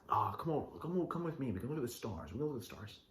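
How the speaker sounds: quietly coax, imitating a smooth talker; mock coaxing. Guy luring a girl out to see stars, mock coaxing.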